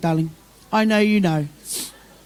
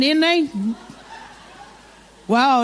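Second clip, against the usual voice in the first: ordinary talk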